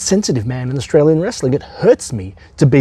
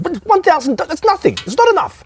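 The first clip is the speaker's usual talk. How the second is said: much higher scornful whine; scoffing; 11 semitones higher than usual. Acted. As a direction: sneer at the lowball offer. Scoffing at small offer.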